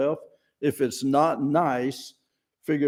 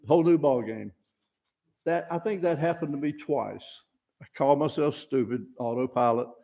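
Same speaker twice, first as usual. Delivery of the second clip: ordinary delivery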